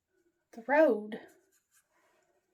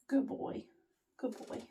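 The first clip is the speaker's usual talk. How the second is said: soft praise to dog; 9 dB quieter than usual. Real, not acted.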